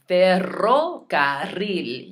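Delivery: "Ferrocarril" slow and loud, trilling both double R's; slow, showy Spanish trills on "Ferrocarril"; rolled R's. Shown as a demonstration. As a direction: roll every R in "Ferrocarril", syllables drawn out. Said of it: "you need to roll all four R's".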